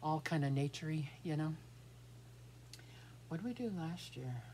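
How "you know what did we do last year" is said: normal talking voice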